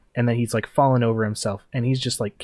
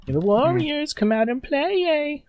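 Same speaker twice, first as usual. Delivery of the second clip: high, sing-song taunting call; Warriors villain taunt; slower than usual. Retold or imitated. Game master quotes the movie villain, sing-song taunt. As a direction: lure them out like a gang boss. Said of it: "the main gang bad guy from the Warriors".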